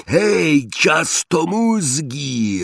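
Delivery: high, drawn-out mocking yell at the troll; taunting roar. A narrator's reading. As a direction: call out to provoke the troll. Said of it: "ryknął Ron". (Ron prowokuje trolla, przeciągły drwiący okrzyk)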